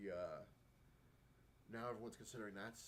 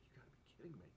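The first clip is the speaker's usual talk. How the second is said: breathless disbelieving whisper; stunned whisper; 3 semitones higher, 7 dB quieter, faster than usual. Retold or imitated. Seeing the book go for six hundred, stunned whisper. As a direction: whisper it in shock at the price.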